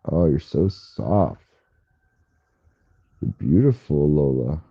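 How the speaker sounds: slow, low, soft murmur; tender murmur to the cat. Real, not acted. Streamer stroking her cat, slow low tender murmur. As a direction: murmur softly, slowly to a pet.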